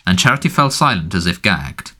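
ordinary delivery

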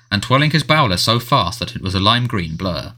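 ordinary delivery

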